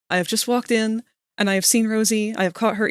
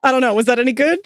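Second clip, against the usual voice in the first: ordinary talk, nothing marked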